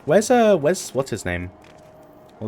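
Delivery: ordinary talk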